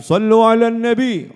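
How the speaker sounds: loud, high shout rallying the crowd to salawat; high-pitched, full-voiced call to prayer. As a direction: cry it out high and loud to a whole gathering. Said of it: "dia teriak".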